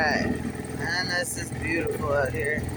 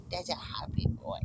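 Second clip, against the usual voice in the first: soft dog talk; 8 dB quieter than usual. Real, not acted. Cooing at the dog.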